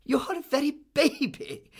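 very high-pitched incredulous cry; scandalised high aunt voice; astonished exclamation. A narrator's reading. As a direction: exclaim at how young she is.

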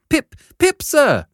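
put-on high, loud terrified boy; frightened shout. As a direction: yell it in panic. Boy shouts his name.

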